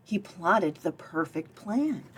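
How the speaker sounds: plain reading voice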